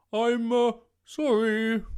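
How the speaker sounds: high, loud, shaken dragon; spluttering. A narrator's reading. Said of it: "he spluttered".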